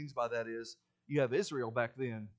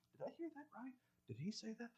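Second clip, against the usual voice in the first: very soft, higher whispered aside; hushed murmuring; 10 semitones higher, 15 dB quieter, faster than usual. Acted. Listeners questioning a speaker among themselves, hushed murmuring. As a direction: lean over and murmur it, fading into whisper. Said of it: "people lean over and say".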